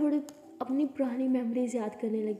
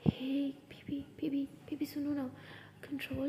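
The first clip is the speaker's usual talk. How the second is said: whispered plea; 3 semitones higher, 5 dB quieter than usual. Acted. Stopping lover in whisper.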